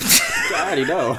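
high squeal of laughter over the words; can't stop laughing; laughing high and loud. Real, not acted. Laughing banter with cohost.

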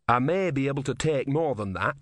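ordinary delivery, nothing marked

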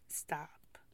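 soft, firm whisper; whispered stop. Real, not acted. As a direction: hush it as a warning.

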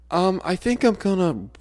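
put-on high, loud queasy kid voice; a boy about to throw up; sick kid. Sick student warning teacher, high queasy voice.

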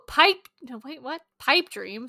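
normal talking voice

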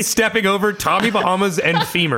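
laughing. Real, not acted. Laughing, high and loud.

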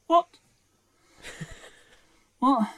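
puzzled and left hanging; baffled viewer. Retold or imitated. Filmgoer walking out confused, mock bafflement. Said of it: "you come out and you go".

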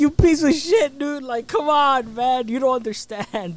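hammed-up indignant yell; mock outrage. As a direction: berate the moocher in exaggerated disbelief. Acted outrage at mooch friend.

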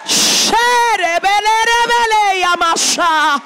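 loud ecstatic tongues, last word slowed; speaking in tongues. Real, not acted. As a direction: let the tongues pour out loud. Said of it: "speaking in tongues".

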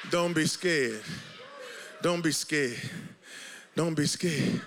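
low, gentle, repeated softly; calm the listener with each repeat; soft reassurance. Real, not acted. Pastor calming the fearful congregation, low and tender.

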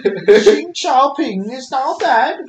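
high, loud, slow mock Chinese accent. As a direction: drag it out in a silly Chinese accent. Joining the Chinese accent bit.

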